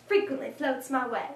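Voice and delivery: plain reading voice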